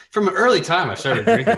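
quoting "From an early time" with a laugh; teasing quote. Retold or imitated. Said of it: "T J talks about having".

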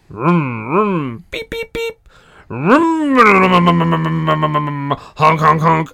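silly vroom and beep noises; car engine and honk sounds; machine noises. Pretend. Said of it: "in the language of, uh, the antagonists".

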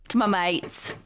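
broad, joking Australian vowels; Australian accent. Acted. Co-host reading mates aloud for a laugh, mock Australian accent. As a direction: put on a rough Australian accent. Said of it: "Just an Australian accent".

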